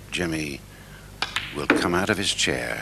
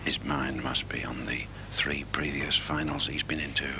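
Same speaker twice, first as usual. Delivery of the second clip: snooker commentary whisper; 2 semitones lower, 5 dB quieter than usual. Real, not acted.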